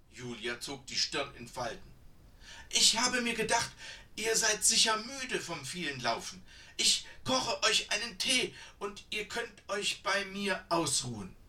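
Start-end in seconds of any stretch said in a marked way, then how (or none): 2.7-11.4 put-on high, louder, insistent old woman; eager old woman. A narrator's reading. Old woman offers tea to the tired sisters, high and eager. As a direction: coax them in like a keen old granny.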